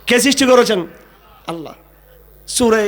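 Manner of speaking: usual speaking voice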